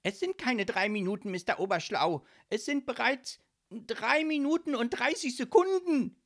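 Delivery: loud, high-pitched indignant correction. A narrator's reading. Ghost counting the seconds of delay, indignant. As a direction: correct him in an outraged shrieky voice. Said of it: "stemmte empört seine Arme in die Hüften".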